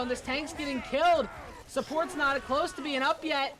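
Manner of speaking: high-pitched casting building to a shout; excited play-by-play. Real, not acted. Live play-by-play of game.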